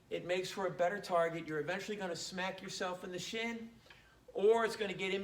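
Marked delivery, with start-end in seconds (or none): none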